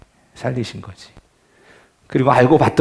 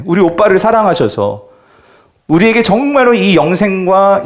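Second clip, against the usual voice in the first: fervent preaching; 8 semitones higher than usual. Acted. Pastor urging the congregation about Mary's devotion, fervently. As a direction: preach it with rising fire. Preacher builds to full force.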